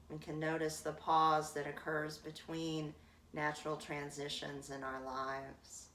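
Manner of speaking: guided meditation. Acted. Yoga teacher guiding her class to notice the breath, calm meditation voice. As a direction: let each phrase settle before the next.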